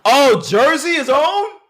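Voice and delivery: loud, high, drawn-out cheer; real whoop of hometown excitement; excited outburst. Real, not acted. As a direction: shout it out in thrilled surprise, stretching the words. Excited reaction to learning it's Jersey.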